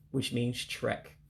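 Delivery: normal talking voice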